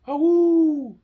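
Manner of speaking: very high, loud wolfish howl; strange howl. Acted. A creature howling in the forest at night, loud. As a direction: howl through the dark forest. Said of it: "he heard a strange noise".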